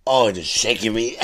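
ordinary talk

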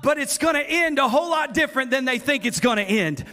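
loud, high, declaring victory; triumphant preaching. Acted. Preacher voicing the psalmist's victory to the church, loud and triumphant. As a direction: proclaim the end like a war cry. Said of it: "He said".

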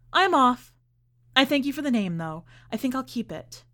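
bright, loud and cheeky; defiant. A narrator's reading. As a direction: toss it off with cheek. Girl leaves him, defiant.

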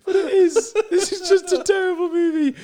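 real laughing fit, very high-pitched squeaky words; helpless laughter. Real, not acted. Cracking up at a terrible movie script, helpless high laughter. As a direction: squeeze the words out through uncontrollable laughter. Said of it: "why this is so funny".

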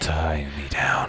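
slow breathy whisper. Pretend. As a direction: breathe it slowly, suggestive. Whispered pun.

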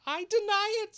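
very high, very loud hare shriek; March Hare voice. A narrator's reading. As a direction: shriek a denial. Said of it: "said the March Hare".

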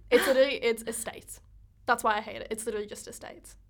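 usual speaking voice

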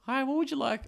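high, bright drive-through greeting; chirpy order taker. Acted. Drive-through worker greets a customer, high chirpy voice. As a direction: put on a high server's voice.

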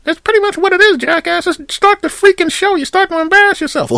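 very high put-on voice for the whole audience; crowd of listeners voice. Host voicing all his listeners answering, very high put-on voice. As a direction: play thousands of fans answering at once, high and loud. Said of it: "me making a voice myself".

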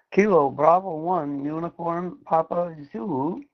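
ordinary talk

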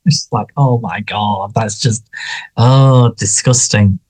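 usual speaking voice

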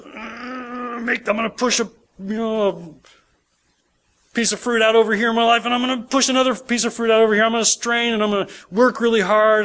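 high, pushing voice of someone forcing fruit out; straining believer. Pretend. Preacher mocking a believer who strains to produce fruit, in a high, effortful voice.

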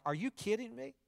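soft, high questioning incredulity; disbelief. Acted. Preacher reacting to people's Facebook posts, in soft rising disbelief. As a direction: lift the pitch at the end, keep it quiet. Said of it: "I'm like".

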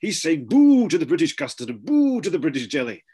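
hammed-up prime minister spluttering; blustery mock Boris; mock Boris Johnson. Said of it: "And then Boris was like".

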